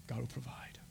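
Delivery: very soft, low whisper. Acted. Abraham to his son before the sacrifice, whispered. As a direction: breathe it out under your voice. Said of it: "Just whisper it".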